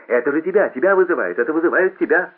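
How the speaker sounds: high, loud, insistent prodding; urgent alert. A narrator's reading. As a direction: press him to respond at once. Friend urgently alerts Paul at table.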